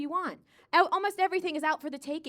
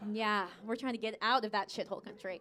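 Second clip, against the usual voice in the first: ordinary delivery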